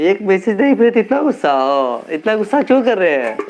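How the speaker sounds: loud, high-pitched complaint; exasperated teasing protest. Real, not acted. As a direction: protest loudly to a sulking friend, voice swooping down at the end. Teasing friend for being cross.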